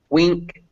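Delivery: "wink" with the vowel of "pin"; short I. Shown as a demonstration. Teacher models a similar spelling for students, "wink" with short I. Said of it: "but it's pronounced".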